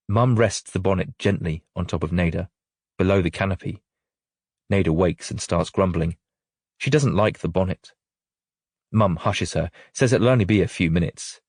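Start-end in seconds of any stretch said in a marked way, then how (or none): none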